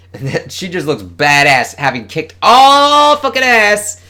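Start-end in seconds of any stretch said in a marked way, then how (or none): 1.8-4.0 much higher, louder, slowed hype; excited gushing. Real, not acted. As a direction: stretch it out in delight. Gushing over heroine.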